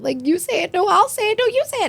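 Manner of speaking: mock flirty pushing, rising to a squeak; giddy bickering. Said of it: "And she's like".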